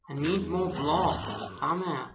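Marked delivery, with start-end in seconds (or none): none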